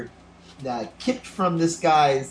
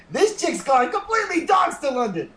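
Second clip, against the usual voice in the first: high-pitched comic shout; hammed-up exclamation, very high; laughing outburst; 12 semitones higher than usual. Comic outburst amid shared laughter.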